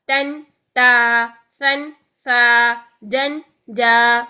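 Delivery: تن then تا, سن then سا, جن then جا, each very slow, the second held long; slow joined and stopped forms. Shown as a demonstration. Teacher slowly drilling joined and stopped tanween pairs. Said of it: "یہ دونوں صورتیں".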